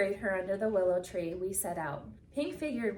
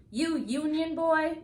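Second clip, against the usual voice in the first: slow, higher mocking jeer; 8 semitones higher, 4 dB louder, slower than usual. A narrator's reading. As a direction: taunt him, stretch it out. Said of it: "one jeered".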